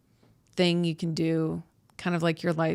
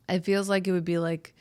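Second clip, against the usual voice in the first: normal talking voice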